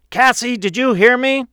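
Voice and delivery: angry father yelling, high and loud. A narrator's reading. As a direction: shout at a child who ignores you. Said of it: "He was now yelling".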